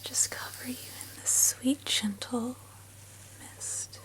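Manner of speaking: fading sleepy whisper, slowing down; soft spa whisper. Acted. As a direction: lull them to sleep in a hush. Bedtime sign-off whisper.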